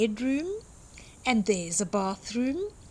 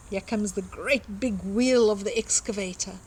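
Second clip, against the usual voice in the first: ordinary delivery, nothing marked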